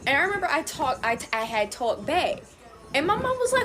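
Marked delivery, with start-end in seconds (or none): none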